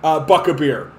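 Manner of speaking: attack ad. Retold or imitated. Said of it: "They're gonna be".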